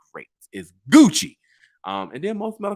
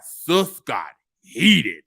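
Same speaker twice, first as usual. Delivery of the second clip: ordinary delivery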